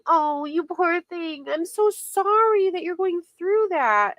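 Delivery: a hint of a fake sympathetic fan; mock fake sympathy. Mimicking fans gushing over Nella, lightly mocking. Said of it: "they would be saying".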